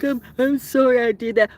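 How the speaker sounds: high, sing-song fake sorry; mock apology. Mocking how narcissists apologize, high and fake. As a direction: mimic a hollow, insincere apology. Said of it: "You don't do, like".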